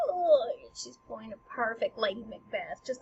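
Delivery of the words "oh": high, brief frantic moan; a mock wail of panic; mock freaking out. Frantic Gertrude wringing hands, mock freak-out moan. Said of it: "you know, freaking out".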